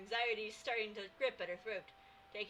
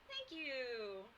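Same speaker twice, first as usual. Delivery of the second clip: ordinary talk, nothing marked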